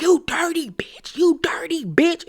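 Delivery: whispered venom, barely voiced; hissed whisper. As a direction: spit the insult in a breathy whisper. Quad's quoted insult, retold.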